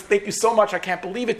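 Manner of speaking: high, excited retold thanks; grateful disbelief. Patient thanks doctor for letting him in, overjoyed.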